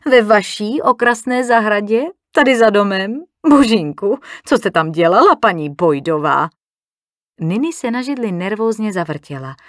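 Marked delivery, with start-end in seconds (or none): 0.1-6.5 louder, gasping mock-shocked questioning; amused astonishment. A narrator's reading. Ardélia hears of the garden meeting, amused astonishment. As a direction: pounce on the news with amused surprise. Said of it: "Ardélia pobaveně zalapala po dechu".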